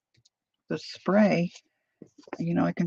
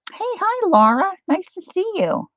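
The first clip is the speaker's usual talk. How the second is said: high, bright hello to a friend; excited greeting; 13 semitones higher, 6 dB louder than usual. Real, not acted. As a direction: call out a cheerful hi. Greeting a viewer on live stream.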